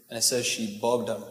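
ordinary talk, nothing marked

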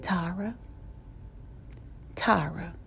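very soft, gentle call of a name; hushed voice from within; still small voice. Retold or imitated. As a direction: call softly, pausing between calls. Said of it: "spoke to me in a still, small voice".

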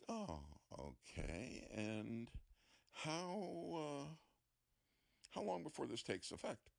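stall, then ask in a high, careful voice; wary hesitation. Acted. Patient asking doctor about the effect, warily hesitant.

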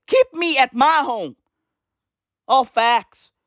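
usual speaking voice

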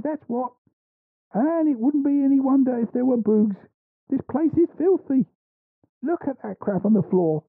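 high, scolding landlady voice; brisk nosy landlady. Acted. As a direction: scold her like a fed-up landlady. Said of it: "The landlady".

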